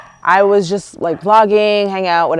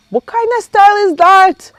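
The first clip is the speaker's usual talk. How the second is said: high-pitched, louder outraged retort; indignant disbelief; 13 semitones higher, 6 dB louder than usual. Retold or imitated. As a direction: exclaim it in squeaky, scandalized disbelief. Acting out her indignant retort.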